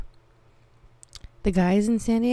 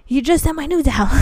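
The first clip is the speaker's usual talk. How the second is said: loud, high-pitched acted objection; retold exasperated correction, voice raised; exasperated protest; 6 semitones higher, 9 dB louder than usual. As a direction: protest loudly in disbelief.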